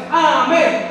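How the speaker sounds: a shouted amen in reply; loud amen. Real, not acted. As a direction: call out amen loudly. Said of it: "¿Cómo es?". (amén fuerte)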